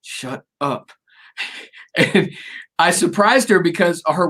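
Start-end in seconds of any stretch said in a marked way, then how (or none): 0.0-0.9 soft shut up. Retold or imitated. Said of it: "she's like".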